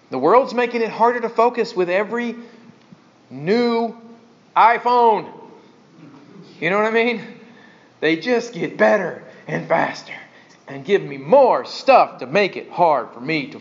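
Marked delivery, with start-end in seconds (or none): none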